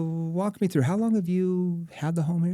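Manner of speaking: curious sales question. Shown as a demonstration. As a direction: sound genuinely curious. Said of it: "That's a curious tone".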